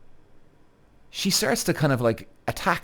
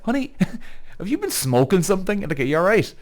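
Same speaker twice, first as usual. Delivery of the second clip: much higher, amused mock-gentle voice; teasing boyfriend; 8 semitones higher than usual. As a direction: tease her sweetly, high and light. Boyfriend laughing at girlfriend.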